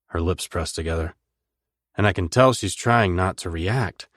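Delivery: ordinary delivery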